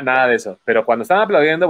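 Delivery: usual speaking voice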